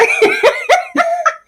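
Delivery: very high, breathless giggling; high giggle. Real, not acted. Teacher giggling at colleague's Sheila voice, high giggle. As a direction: dissolve into giggles. Laughing after Sheila line.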